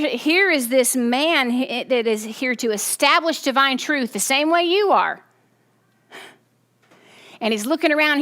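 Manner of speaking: usual speaking voice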